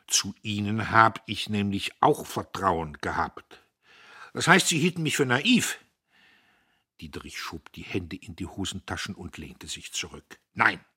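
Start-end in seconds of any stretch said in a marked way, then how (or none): 0.1-5.8 a father flushing with offence; rising anger. Acted. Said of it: "Göppels Stirn fing an, sich zu röten". 10.5-10.8 loud curt denial; sharp no. Acted. Diederich weist ab.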